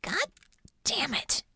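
high, soft curse under the breath; quietly strained swearing; muttered curse. A narrator's reading. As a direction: mutter it, pained and tense. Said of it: "Bella muttered".

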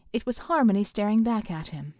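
ordinary delivery, nothing marked

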